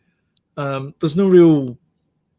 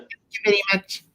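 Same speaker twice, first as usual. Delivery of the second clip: bad high-pitched sitcom character impression; badly imitated character catchphrase; Latka from Taxi; 6 semitones higher, 4 dB quieter, faster than usual. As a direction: put on a higher, odd comic voice. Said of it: "really bad impersonation of Latka from Taxi".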